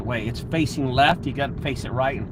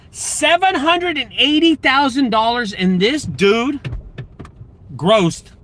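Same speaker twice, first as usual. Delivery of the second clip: high, loud and slow, hammering each figure; astonished; 9 semitones higher, 5 dB louder, slower than usual. Real, not acted. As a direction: spell out the numbers in outrage. Outraged retelling of the numbers.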